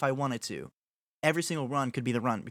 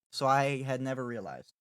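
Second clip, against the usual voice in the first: normal talking voice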